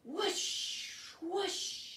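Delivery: rising whistling gust; whooshing wind. Shown as a demonstration. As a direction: wind sound again for the children. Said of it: "Can you make the sound of wind".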